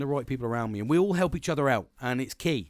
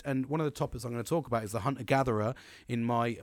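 ordinary talk

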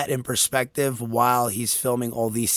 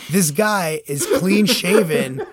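higher, louder, slow and emphatic; laughing disbelief; 7 semitones higher, 6 dB louder, slower than usual. Real, not acted. Telling co-host about the man in the wilderness, laughing disbelief. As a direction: hammer it out, amazed and amused. Amazed rant over clean shave.

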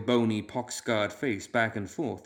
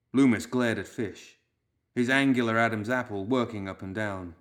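ordinary delivery

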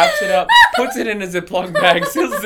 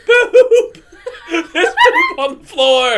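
put-on high, loud, slow kid shout laughing through it; much higher, a kid announcing poop to the class; obnoxious kid yelling; 12 semitones higher, 9 dB louder, much slower than usual. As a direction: yell it like a gleeful tattletale. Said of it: "he goes".

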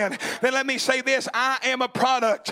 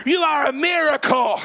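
preacher shouting the climax; shouted climax; 3 semitones higher than usual. Real, not acted. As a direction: throw it to the back row. Climax of repeated line.

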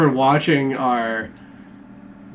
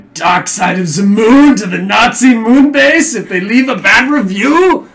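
louder and higher, laughing mock threat; gleeful joking; 9 semitones higher, 11 dB louder than usual. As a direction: gleefully banish the bad reviewers. Joking about banishing bad reviewers.

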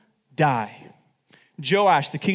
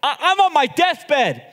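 much higher and louder, furious rebuke; angry shout; 9 semitones higher, 5 dB louder than usual. Retold or imitated. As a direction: yell at the king from a deathbed. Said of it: "he gets angry at the king".

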